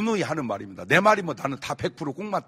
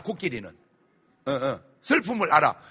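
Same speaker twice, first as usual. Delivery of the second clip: ordinary talk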